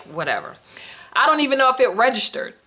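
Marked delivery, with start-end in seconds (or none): 0.0-0.6 low, soft dismissive mumble to herself. Retold or imitated. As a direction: shrug it off under your breath. Unbothered reaction, retold.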